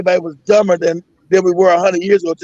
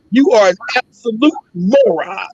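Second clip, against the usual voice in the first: slow, each word weighed: "absolute moron"; slow contempt. Real, not acted. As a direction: spell out the insult, unhurried.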